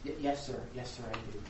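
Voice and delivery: lightly voiced polite, quiet girl; meek reply. Girl to mocking lawyer, meek and soft.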